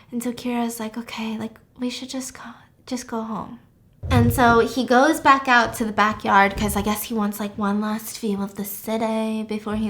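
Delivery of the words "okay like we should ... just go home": soft, half-whispered urging; hushed suggestion. Retold or imitated. Kira urges Will to go home, hushed and gentle. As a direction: suggest leaving under your breath.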